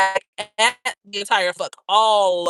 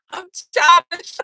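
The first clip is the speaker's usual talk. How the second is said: put-on higher, louder, slow babyish whine on "childish"; mock childish voice; 6 semitones higher, 7 dB louder, slower than usual. Shown as a demonstration. Owning her childish side to viewers, babyish whine. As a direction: stretch out "I'm childish" like a whiny kid. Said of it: "I'm childish as fuck".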